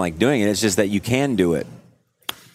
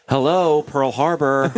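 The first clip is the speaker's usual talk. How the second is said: higher, drawn-out imitation of her; mock-incredulous punchline; 6 semitones higher, slower than usual. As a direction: quote her like it's painfully obvious. Retelling a relative's mocking punchline.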